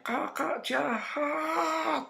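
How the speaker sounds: high, long drawn-out cry of accusation; imitated wail. Girl cries out at Kakak in church, imitated high wail. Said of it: "Terus dia teriak".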